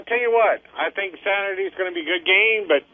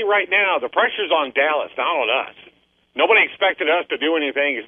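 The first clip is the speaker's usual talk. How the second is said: usual speaking voice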